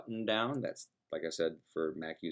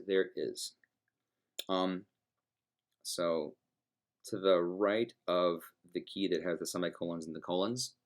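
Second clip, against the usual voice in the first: ordinary delivery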